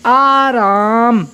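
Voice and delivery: आराम very slow, both आ held; long vowels. Shown as a demonstration. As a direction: draw out आराम fully. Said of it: "इससे हमारा क्या बन जाएगा?".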